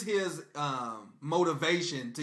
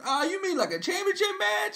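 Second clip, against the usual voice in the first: much higher mock surprised woman's voice; imitating Stephanie; 4 dB louder than usual. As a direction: squeak it out as a fake-innocent Stephanie. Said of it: "And then Stephanie said".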